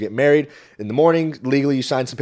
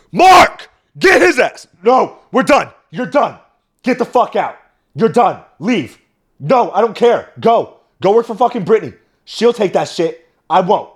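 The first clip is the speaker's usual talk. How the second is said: screaming, much higher and louder; furious rant; 9 semitones higher, 8 dB louder than usual. Retold or imitated. Comedian acting out the rant he held back, yelling. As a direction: explode at him and throw him out. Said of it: "that's what I really wanted to say".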